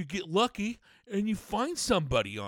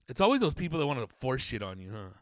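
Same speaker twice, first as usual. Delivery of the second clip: normal talking voice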